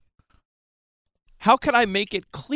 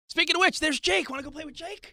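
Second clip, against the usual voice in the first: high, soft kiddie voice; talking to a child; 9 semitones higher, 5 dB quieter than usual. Acted. Dad sending child to play with Jake, high and soft. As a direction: lift it high and light.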